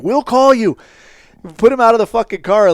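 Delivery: loud, curt brush-off; curt loud dismissal. Retold or imitated. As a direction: shut it down sharply. Dismissing informant loudly.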